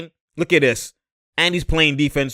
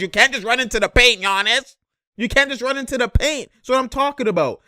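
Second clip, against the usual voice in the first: higher, hyped insistence; excited; 8 semitones higher than usual. Real, not acted. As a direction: drive the point home, fired up. Excited breakdown point.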